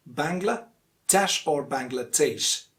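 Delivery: Bangladesh ending DESH, then DAYSH; short middle in "Bangladesh", ending first desh, then daysh; two correct variants. Shown as a demonstration. Teacher correcting learners with both ways, two variants of Bangladesh. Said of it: "but it's actually".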